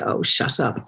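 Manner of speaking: soft, unvoiced mock brush-off; mother brushing off a pushy father, muttered; dismissive mutter. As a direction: mutter it off under your breath. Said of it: "And you go".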